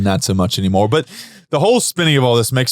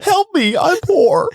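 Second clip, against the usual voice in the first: very high, squeaky mock begging; mock plea; slower than usual. Teasing the cohost as poor, a high mock plea. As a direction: plead in a silly high voice.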